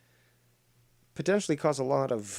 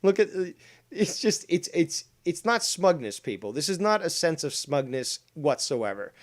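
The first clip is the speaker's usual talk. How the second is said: ordinary talk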